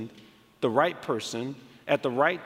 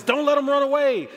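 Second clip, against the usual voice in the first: higher-pitched urgent call; imitated urgent call; 9 semitones higher, 4 dB louder than usual. Retelling a helper warning others about the fleeing boy, urgent call. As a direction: call out as if someone is escaping. Bystander calls out as boy flees.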